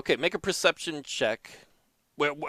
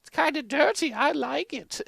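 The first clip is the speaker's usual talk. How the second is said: put-on higher, practical old wife; lightly voiced old wife; 14 semitones higher than usual. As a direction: chime in as a sensible old wife. Gina voice, higher, softer.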